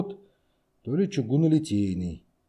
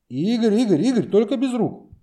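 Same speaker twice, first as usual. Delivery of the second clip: alarmed shout; 9 semitones higher, 5 dB louder than usual. Acted. Backing away from angry friend.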